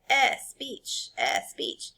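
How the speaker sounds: lisping without his teeth; a lisping old father without dentures; toothless lisp. A narrator's reading. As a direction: mumble it through bare gums. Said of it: "so he lisped when he said".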